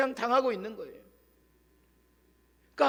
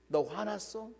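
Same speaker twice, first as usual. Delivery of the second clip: quiet question; 8 dB quieter, slower than usual. Retold or imitated. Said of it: "조용히 묻습니다".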